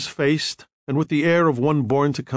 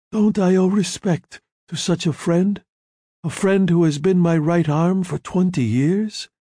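lightly voiced old don, raised pitch; a touch of a gracious godfather; a hint of a calm don; 6 semitones higher than usual. A narrator's reading. Don Corleone voiced in story.

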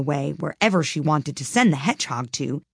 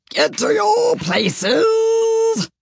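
very high, hugely drawn-out bellow; thunderous shout; 5 dB louder, much slower than usual. A narrator's reading. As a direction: bellow the order, stretching the last word endlessly. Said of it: "shouted the Queen in a voice of thunder".

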